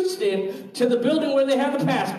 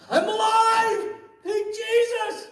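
relieved joy; 13 semitones higher, slower than usual. Retold or imitated. Relief after gunmen turn friendly.

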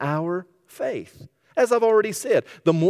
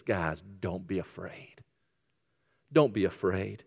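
calm reassurance; 6 semitones lower, 8 dB quieter than usual. Acted. Jesus calming scared disciples in the storm, hushed and gentle. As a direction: soothe frightened friends quietly.

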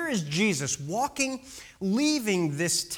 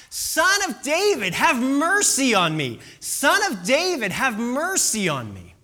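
high, loud pleading call; acted shout; 5 semitones higher, 5 dB louder than usual. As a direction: call out for mercy over a crowd. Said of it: "he begins to shout".

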